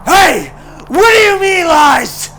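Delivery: high, very loud furious repeat; yelling. A narrator's reading. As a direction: shout it at his back. Joker repeats, now yelling.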